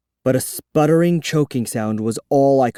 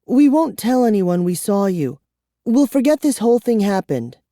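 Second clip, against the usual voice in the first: put-on higher pleading girl's voice; 9 semitones higher than usual. A narrator's reading. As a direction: bargain with him earnestly. Kara pleads.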